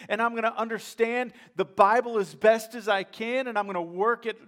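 rally the room with conviction; full-force preaching. Acted. Preacher voicing a committed believer's vow, booming.

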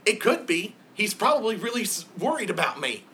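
a small worried pet piping up; hamster voice. Acted. Boo the hamster talking.

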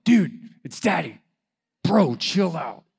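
acted exasperated outburst, drawn out at the end; frustrated dad. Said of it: "in my frustration".

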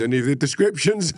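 cracking up through the line; breaking into laughter. Real, not acted. Retelling the judge's acquittal of his mate, cracking up. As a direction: start composed, rise into helpless laughter.

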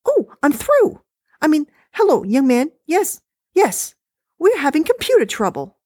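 dismissive, high and chatty; wife voice. Acted. Wife complaining to husband about the recording, high-pitched.

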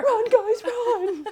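high laughing shout to flee; mock panicked yell. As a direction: scream at them to run, half laughing. Mock panic fleeing a dinosaur.

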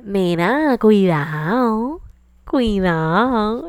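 slow drawn-out singsong warning; coworkers warning. Retold or imitated. Coworkers teasing her, slow singsong warning. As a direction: stretch each cuidado teasingly. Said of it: "los que trabajan conmigo".

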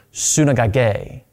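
second Greek word, drawn out slowly; slow reading. Shown as a demonstration. Preacher letting listeners hear the similar Greek word, slowly. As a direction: pronounce it slowly and deliberately. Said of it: "I'm gonna read it slowly".

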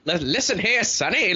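put-on high, crotchety old-timer; cranky old man. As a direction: scold like a cranky grandpa. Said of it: "There's certain voices that I'm kinda just like".

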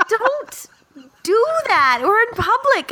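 put-on high-pitched scolding of her boyfriend; high mock scold. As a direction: squeal it like a shocked girlfriend. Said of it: "And I was like".

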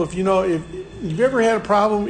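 ordinary talk, nothing marked

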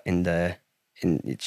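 ordinary delivery

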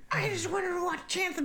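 very high comic wail; mock plaintive protest. Player caught in shootout at a screening, mock plaintive. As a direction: plead it in a squeaky hammed-up voice. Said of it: "It was like".

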